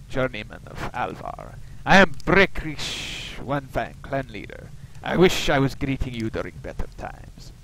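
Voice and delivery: lightly put-on Russian accent, solemn clan leader; Slavic Russian accent. Orc clan leader greets the newcomer, mild Russian accent. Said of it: "given him a kind of a Slavic Russian accent".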